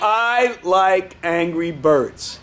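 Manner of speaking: very slow, deliberate words; slow model phrase. Shown as a demonstration. Teacher modelling the line for Tito, very slowly. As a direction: space out each word for copying. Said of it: "Just say, eh".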